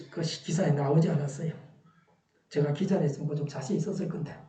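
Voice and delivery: usual speaking voice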